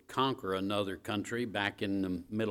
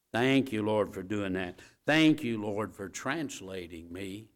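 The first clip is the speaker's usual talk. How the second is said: a rising, repeated thank-you prayer; lightly preached prayer of thanks; 3 semitones lower than usual. Shown as a demonstration. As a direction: pray it with building praise. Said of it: "I don't think it hurt us every day to say".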